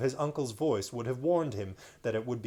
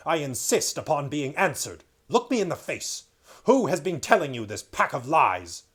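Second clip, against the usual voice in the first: loud, commanding demand; angry king; 3 semitones higher, 8 dB louder than usual. Acted. King Miraz angrily demanding the truth from Caspian. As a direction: insist on an answer, hard and loud.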